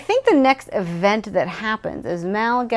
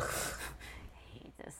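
very soft, slow whisper; mock miserable whisper; 25 dB quieter, much slower than usual. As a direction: whisper it slowly in defeat. Acts Joel miserable as number one.

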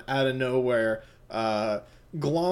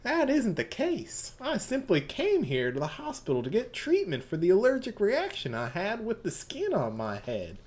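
Southern Belle; 3 semitones higher than usual. Acted. GM voicing Mitsuba to Yasuho as a sweet Southern belle. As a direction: play the genteel Southern lady, all honey. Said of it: "doing her Southern Belle".

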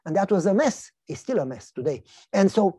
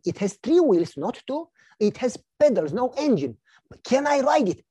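put-on eager child; 6 semitones higher, 4 dB louder than usual. Son bargains for bike.

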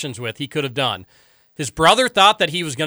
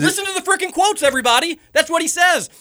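exasperated; 13 semitones higher than usual. Real, not acted. Ranting at texters.

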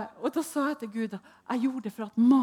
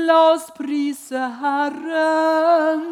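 slow, loud, higher sung-out call; mock worship leader; 6 semitones higher, 11 dB louder, much slower than usual. Mocking the church leader calling to praise, slow and loud. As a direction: stretch it like a hammed-up worship leader. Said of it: "når vi skulle synge".

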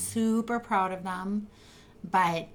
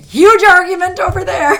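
shouted, high and loud; loud announcement; 11 semitones higher, 15 dB louder than usual. Retold or imitated. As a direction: burst in shouting the news. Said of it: "I come marching across the street saying".

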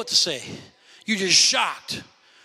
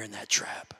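whisper; 11 semitones lower, 9 dB quieter than usual. Acted. Preacher warning the church, whispered. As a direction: drop to a breathy whisper. Warns of being trapped by offense.

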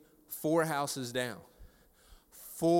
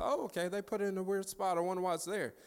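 higher-pitched musing to yourself; lightly puzzled aside; 7 semitones higher, 4 dB quieter than usual. Retold or imitated. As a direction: think aloud in a lifted voice. Retold musing to himself.

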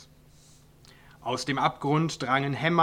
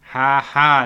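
a flat, loud ha ha with no joy in it; humorless laugh; 12 dB louder than usual. A narrator's reading. Grumpy dwarf mocking the neighbour with humorless laughter. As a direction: spit out two dry syllables of fake laughter. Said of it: "lachte der Zwerg humorlos".

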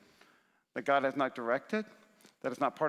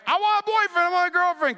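high, loud mock teenager pleading; whiny teen prayer; 13 semitones higher, 7 dB louder than usual. Pastor mimics a high schooler begging God, high whiny plea.

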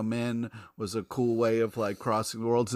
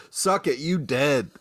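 gloating taunt; 4 semitones higher, slower than usual. Acted. Taunting with celebration dance.

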